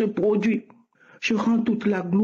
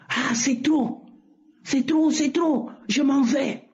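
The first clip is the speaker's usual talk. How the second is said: strange voice not hers; 5 semitones higher than usual. Retold or imitated. Retelling the voice that took over at prayer, higher pitched. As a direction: voice raised higher, a stranger speaking through her. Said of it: "c'était pas ma voix".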